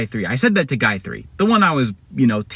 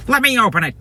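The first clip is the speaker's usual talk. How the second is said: high, eager put-on voice; excited bad guy; 10 semitones higher, faster than usual. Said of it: "he's really excited".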